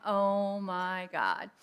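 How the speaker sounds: very slow, drawn-out dread; retold dismay. Woman finding out she is pregnant, slow stunned dismay. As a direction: drag each word out in stunned realization.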